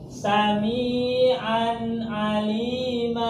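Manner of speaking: slow melodic recitation, "Sami'an alima" with unhummed n; clear tanwin. Shown as a demonstration. Teacher showing a tanwin example, clearly recited. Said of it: "harus jelas".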